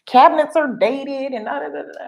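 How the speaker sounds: high, indignant mimicry; imitating the indignant neighbor; mocking the neighbor. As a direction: rant as the neighbor. Neighbor complaint continues.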